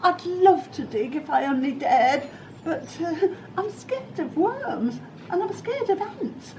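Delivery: very high, stammering frightened voice with giggles; scared cook. Acted. Cook asked to dig, very high stammering fright. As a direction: quaver it, heart thumping. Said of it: "the cook declared".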